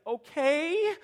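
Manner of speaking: high, small hurt okay; a small wounded okay; hurt little okay. Shown as a demonstration. Dad showing the okay his son says when hurt. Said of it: "if he's hurt, he's like".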